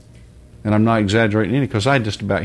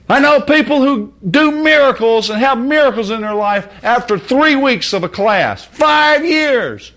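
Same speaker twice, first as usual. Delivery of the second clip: outraged shout; 7 dB louder, slower than usual. Real, not acted. Preacher erupts in outrage.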